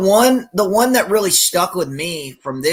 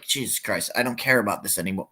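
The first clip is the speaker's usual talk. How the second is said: low, much softer, fast mutter; bored muttering; 9 semitones lower, 8 dB quieter than usual. Retold or imitated. Fed up with the serious ads, a quick low mutter. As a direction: mumble it fast like you've lost interest. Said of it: "I was like".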